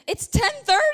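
high, loud alarmed question; startled question. Retold or imitated. Husband wakes confused, startled by the time. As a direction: jump up in alarm. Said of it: "He said".

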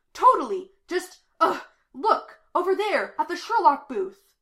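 loud, high outburst of frustration; urgent, exasperated teenager; frustrated. A narrator's reading. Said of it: "The teenager looks frustrated".

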